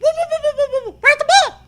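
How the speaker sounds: very high, slow, laughing squeal of a coach; panicked coach yelling. Acted. Coach Curley running up to the player, panicked yell. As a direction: shriek it in a comic panic. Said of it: "practically yelling".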